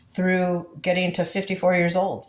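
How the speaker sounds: usual speaking voice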